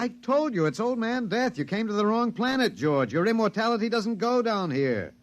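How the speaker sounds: high, exultant taunt; triumphant taunting. Acted. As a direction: rub it in with glee. Taunting aliens about death.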